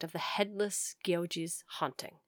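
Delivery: spooky whispered narration; whispering. A narrator's reading. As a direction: hush it like a ghost story. Spooky story line.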